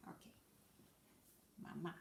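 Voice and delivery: normal talking voice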